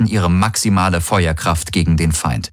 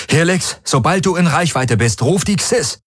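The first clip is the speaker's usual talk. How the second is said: high command, rising at the end; urgent order; 9 semitones higher than usual. A narrator's reading. Garland orders Helix to call the Xis, urgent. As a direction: press the order, pitch climbing.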